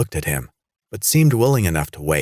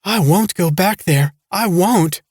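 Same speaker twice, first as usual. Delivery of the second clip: high, loud frightened insistence; panicked refusal; 13 semitones higher, 5 dB louder than usual. A narrator's reading. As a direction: refuse in terror. Hysterical refusal after shriek.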